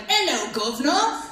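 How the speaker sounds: mock Cockney greeting, pitched a little up; thick Cockney. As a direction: put on grandma's thick Cockney accent. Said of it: "She has a more Cockney accent".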